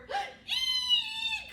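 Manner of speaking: very high loud shriek; cow shriek. Acted. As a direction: shriek like frightened cows. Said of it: "said the cows".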